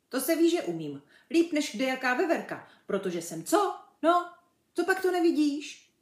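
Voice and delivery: high, loud, boastful chimp voice; show-off animal, indignant at being doubted; cocky chimp. A narrator's reading. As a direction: brag with exasperated energy.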